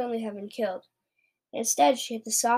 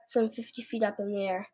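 usual speaking voice

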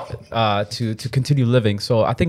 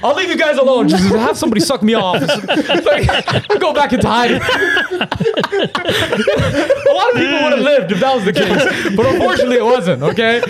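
talking through high laughter; words breaking with laughter; laughing; 13 semitones higher, 3 dB louder than usual. Real, not acted. S0 cracking up at joke.

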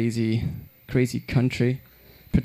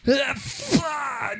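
high exclamation; 11 semitones higher, 3 dB quieter than usual. Pretend. Pollen disaster.

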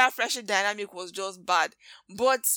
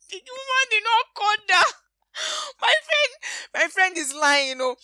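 laugh, then shout it high and loud; dramatic outburst; 13 semitones higher, 4 dB louder than usual. Retold or imitated. Kiki laughing to the room, loud and high. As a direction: go shrill with disbelief, laughing.

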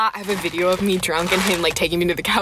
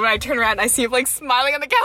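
ordinary talk

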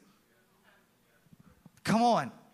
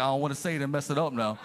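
nervous mumble; 8 semitones lower, much faster than usual. Retold or imitated. Nervous congregant voiced.

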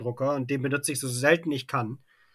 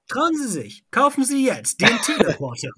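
bright pushy pitchman, raised; chipper 1950s ad; 7 semitones higher, 4 dB louder than usual. Pretend. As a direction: sell it like an old TV ad. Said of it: "Anfang eines Werbespots".

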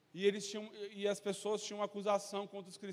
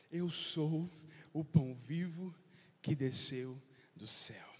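a hint of a lower, slow, measured Jesus voice; 5 semitones lower, slower than usual. Preacher voicing Jesus to the crowd, lightly lower and slow.

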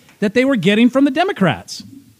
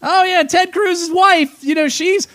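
mock outrage; 6 semitones higher than usual. Host mimicking critics of Ted Cruz and Goldman Sachs, mock outrage. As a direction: mimic an alarmist critic, pitched well up.

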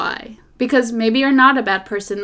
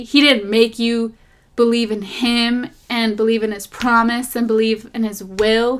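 usual speaking voice

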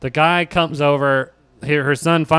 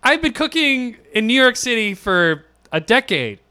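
indignant; 8 semitones higher than usual. Retold or imitated. Defending herself against blame.

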